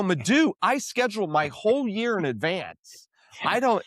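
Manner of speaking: exasperated, high-pitched objection; re-enacted pushback, pitched well up; retold protest. Retold protest to his friend.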